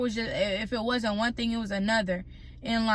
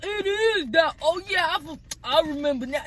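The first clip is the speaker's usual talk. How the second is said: mocking the clerk; 6 semitones higher than usual. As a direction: parrot her fake "oh yeah" back at her. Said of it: "she over here".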